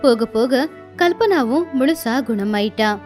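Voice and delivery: ordinary delivery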